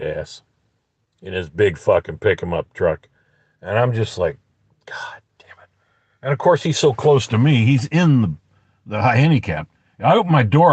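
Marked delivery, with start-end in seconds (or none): 4.9-5.7 cursing under the breath; hushed, fed-up swearing; exasperated whisper. Retold or imitated. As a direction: whisper it in re-enacted disbelief. Muttered exasperation in retelling.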